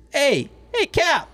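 high, loud New York drunk voice impression; Brooklyn accent imitation. Drunk client calling the captain, Brooklyn accent. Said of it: "what that accent was".

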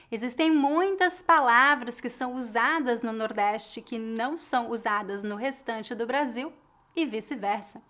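usual speaking voice

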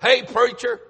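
high, loud gruff greeting; mad caller. Retold or imitated. Man calling the preacher Monday morning, loud and gruff. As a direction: bark it out like an angry man. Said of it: "And I heard this voice".

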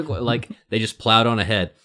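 normal talking voice

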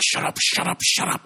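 frantic voiceless shushing; imitated freak-out. Girlfriend hushing prankster boyfriend, imitated freak-out. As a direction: urgently hush him, acted out. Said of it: "freaking out, like".

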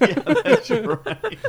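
high, slow, laughing yeah; giggling agreement. Real, not acted. Laughing along.

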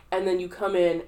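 normal talking voice

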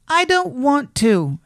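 high, slow, stubborn rabbit voice; drawn-out high-pitched refusal; reluctant little rabbit. A narrator's reading. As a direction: refuse slowly in a small high voice. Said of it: "he said again".

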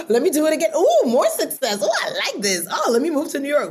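high, eager gasp of mock delight; acted-out thrill. Comic retelling a first taste of success, excited. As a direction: get carried away, pitch jumping up.

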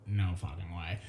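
low, hushed stunned disbelief; muttered disbelief. Retold or imitated. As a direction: breathe it out under your breath. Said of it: "And I'm like".

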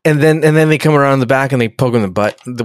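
ordinary delivery

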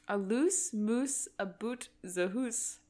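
slow, light Scottish try; Scottish accent attempt. Shown as a demonstration. Canadian testing the Scottish phrase, slow light accent. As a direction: drawl out the hoose in mock Scots. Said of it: "And the phrase is".

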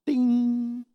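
high, held "ding"; imitated intercom chime. Preacher mimics the plane's intercom going on, sung-out chime. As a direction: mimic a cabin chime, sustained on one pitch.

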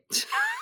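high, loud laugh close to tears; a laugh breaking into a sob; tearful laugh. Real, not acted. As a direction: laugh that cracks toward crying. Admits crying over a book.

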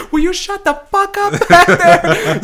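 high, slow put-on screech; scary-movie scolding. Old teacher silencing the backstage laugher, mock horror-film scolding. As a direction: draw it out high like a horror-spoof character. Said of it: "Straight out of Scary Movie".

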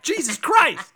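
loud dismayed exclamation; surprised. Real, not acted. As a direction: blurt it out in alarm. Realizing they are like that.

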